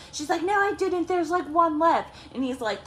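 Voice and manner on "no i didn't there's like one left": high, indignant mock protest; imitated squabbling comeback; retold defensive denial. Sister denies eating them all.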